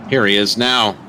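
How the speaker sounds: normal talking voice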